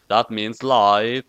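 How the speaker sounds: ordinary delivery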